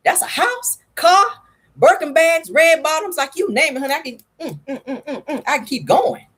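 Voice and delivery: high, sassy mock rant about missing Birkin bags; mock angry Camille. Wife scolding husband over the money, in a high sassy voice. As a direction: play the wife fuming over lost luxury money. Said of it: "And I know Camille mad, like".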